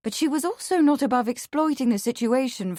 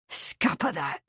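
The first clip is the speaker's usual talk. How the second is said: pitched down, contained fury; low, furious; 4 semitones lower, 4 dB quieter than usual. A narrator's reading. Marsh quarrels with Meg, low and furious. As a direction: growl it low and curt, holding the anger in. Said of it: "furious voice".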